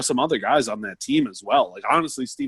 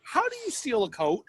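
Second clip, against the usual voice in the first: naive question; 12 semitones higher, 6 dB quieter than usual. Retold or imitated. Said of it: "And I said".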